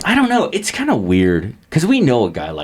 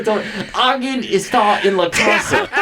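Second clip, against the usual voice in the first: laughing; 13 semitones higher, slower than usual. Retold or imitated. Neighbor's Google Translate Spanish.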